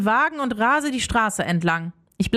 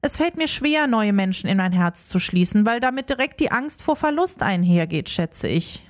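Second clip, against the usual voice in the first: ordinary delivery, nothing marked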